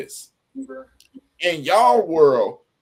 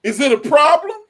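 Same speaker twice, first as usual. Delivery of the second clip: confrontation; 8 semitones higher, 7 dB louder than usual. Retold or imitated. Men's world confrontation quoted.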